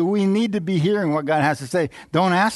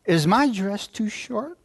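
retold girl's question; 6 semitones higher, slower than usual. Retells girl asking him, higher.

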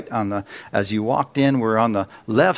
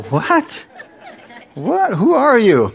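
high-pitched mock disbelief, building louder; hammed-up astonishment, voice leaping up; incredulous; 8 semitones higher, 4 dB louder than usual. Husband stunned his wife wants church again, incredulous.

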